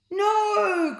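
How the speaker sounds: very high, loud cry; loud shrill cry; cried out. A narrator's reading. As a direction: cry out in horror. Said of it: "cried the pair".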